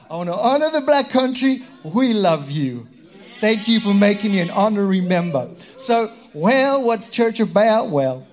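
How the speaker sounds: high, loud, showy put-on voice; hammed-up character voice. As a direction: belt it out as a comic turn. Hammed-up repeat after accent confession.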